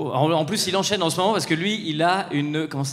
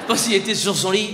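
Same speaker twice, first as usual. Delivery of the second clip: ordinary talk, nothing marked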